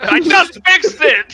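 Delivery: high, loud, drawn-out complaint; mock exasperated yell. Bandit with just-repaired crossbow, mock exasperated yell. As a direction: wail it in comic outrage, half laughing.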